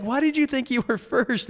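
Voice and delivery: high, baffled asking; amused question. Retold or imitated. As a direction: ask it high, half laughing. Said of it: "We're like".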